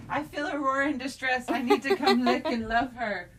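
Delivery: high pretend dog voice; puppy's voice. Voicing the puppy who wants to comfort her, high. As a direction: voice the puppy sweetly. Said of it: "She's like".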